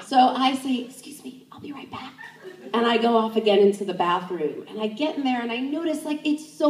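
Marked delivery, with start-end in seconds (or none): 0.8-2.1 hushed quick excuse; whispering. Retold or imitated. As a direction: murmur it under your breath.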